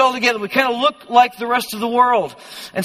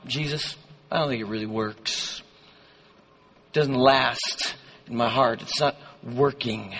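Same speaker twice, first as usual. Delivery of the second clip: low, soft, defeated admission; resigned; 8 semitones lower, 6 dB quieter than usual. Acted. Preacher playing doubter telling Jesus it failed, low resignation. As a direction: quietly give up on it.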